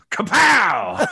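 loud high kapow; punch sound effects. Pretend. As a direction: shout the punch noise like a comic. Punch in the taint.

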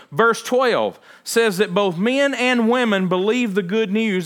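a pastor preaching hard to his congregation; full-force preaching. Acted. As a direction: proclaim it from the pulpit with mounting fervor. Preaching Acts with rising force.

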